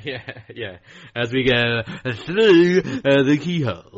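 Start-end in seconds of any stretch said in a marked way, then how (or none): 1.1-4.0 slow, drawn-out TV presenter voice; Lloyd Grossman voice. Retold or imitated. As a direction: stretch each word like a host. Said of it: "He had, like, a really slow".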